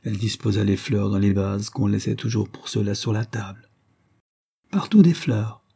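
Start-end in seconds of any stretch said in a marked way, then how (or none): 4.7-5.6 higher, gaily sung-out words. A narrator's reading. Madwoman arranging flowers for Armand, gaily. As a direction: repeat it with childlike cheer. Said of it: "répétait-elle gaiement".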